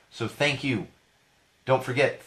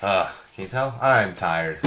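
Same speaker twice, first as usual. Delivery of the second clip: ordinary delivery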